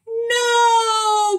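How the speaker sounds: long, very high, loud wail; drawn-out storybook howl; anguished cry. A narrator's reading. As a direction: cry out in despairing protest. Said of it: "cried Skunk".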